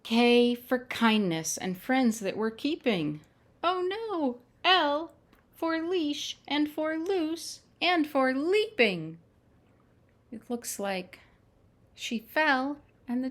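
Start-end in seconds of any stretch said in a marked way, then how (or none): 0.0-3.2 bright, lilting picture-book reading; reading to children. Acted. Storyteller reads the alphabet book to children, lilting. 3.6-4.4 a high, sudden oh no; surprised. Acted. Librarian reacts to the puppy running off, surprised. 4.6-9.2 higher, eager picture-book voice; excited reading. Acted. Storyteller reads the runaway page to children, excited.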